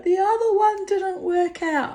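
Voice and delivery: high, loud mock lad voice; cheeky young man explaining the photo; young man quoted. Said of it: "He's like".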